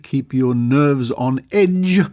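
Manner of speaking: ordinary talk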